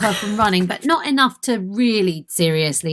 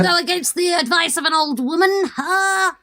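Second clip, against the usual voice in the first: put-on higher-pitched Orcish accent; 7 semitones higher than usual. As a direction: argue your case in a put-on orc accent. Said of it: "orc accent".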